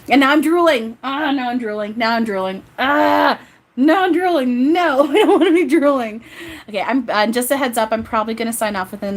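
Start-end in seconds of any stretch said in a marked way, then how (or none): 0.1-6.2 high, loud cries through laughter; laughing dismay. Real, not acted. Reader drooling from laughing so hard, laughing dismay. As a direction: wail it helplessly, giggling at yourself.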